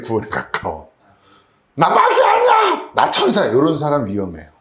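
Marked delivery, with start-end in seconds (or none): 1.8-3.5 high, loud shouted protest; angry denial. Pretend. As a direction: yell back, offended. Angry spouse denies being devil. (화내며 소리치는 항의)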